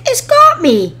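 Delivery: very high, loud panicked cry. A narrator's reading. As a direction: squeal it out in fright. Duncan dragged up incline.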